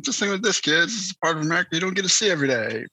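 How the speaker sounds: high, quick, chipper movie-dad patter; Chevy Chase in Vacation. Retold or imitated. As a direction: imitate an upbeat dad selling the sights to the kids. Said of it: "just like Chevy Chase and Vacation".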